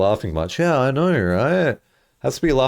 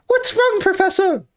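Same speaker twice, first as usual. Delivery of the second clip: put-on squeaky high young boy; 4 dB louder than usual. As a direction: pitch way up like a kid. Acting Luke in skit.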